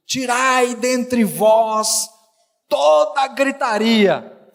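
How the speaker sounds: very slow, stretched-out declamation; lettura solenne. Acted. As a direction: draw out each word of the verse with weight. Reading the verse aloud emphatically.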